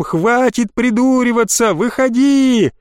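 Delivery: high, drawn-out shouted order; frantic yell. A narrator's reading. Boy ordering hidden friend out, frantic yell. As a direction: demand that they show themselves. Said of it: "заорал я не своим голосом".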